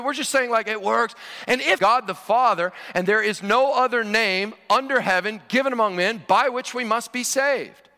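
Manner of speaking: ordinary talk, nothing marked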